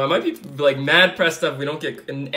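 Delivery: ordinary delivery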